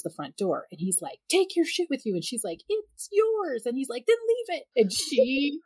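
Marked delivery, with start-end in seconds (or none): 1.3-2.1 high-pitched mock yell; retold exasperated command; husband's angry order. Husband yelling at departing intruder. 2.7-3.7 very high retort; imitated stranger answering back; protesting intruder. 4.1-4.6 very high mock yell; retold comeback, voice pitched way up; exasperated husband.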